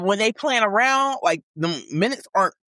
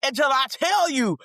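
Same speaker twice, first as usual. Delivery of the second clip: high-pitched bossy parent order; commanding mom voice; 8 semitones higher than usual. Acted. Mom acting out morning orders to her kids, commanding and high. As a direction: lay down the law to dawdling kids. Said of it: "put some bass in your voice".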